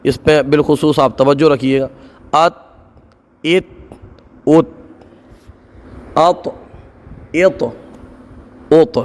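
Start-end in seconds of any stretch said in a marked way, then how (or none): 2.3-9.0 each syllable "अत" ends in a bouncing echoed stop; slow, separate syllables with a rebound on the final consonant; qalqala drill. Shown as a demonstration. Quran teacher drilling students, bouncing echoed qalqala syllables. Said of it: "कलकला होगा".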